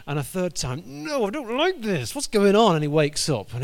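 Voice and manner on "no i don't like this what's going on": high, loud rising protest; mock distressed cat. Cat protests the third backwards stroke, high and loud.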